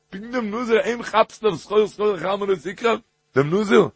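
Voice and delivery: high-pitched, quick incredulous questions; acted incredulous questioning. Teacher questioning the warning given to the nazir, incredulous. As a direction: lift the voice high, challenge each phrase.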